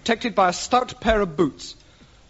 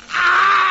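high shouted ah; 12 semitones higher, 7 dB louder than usual. Acted. Mock cry of pain.

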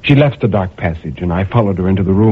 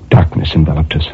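plain reading voice

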